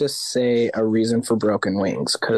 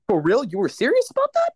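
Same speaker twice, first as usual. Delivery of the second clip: high, squeaky disbelief; can't believe they meant it; 12 semitones higher than usual. Retold or imitated. Stunned singer asking the band if they were serious, high-pitched.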